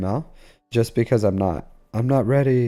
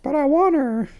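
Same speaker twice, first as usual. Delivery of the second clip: very high, tiny cartoon mouse voice; mimicking a chat friend in a high cartoon squeak; squeaky Mickey Mouse. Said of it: "why are you talking like Mickey Mouse".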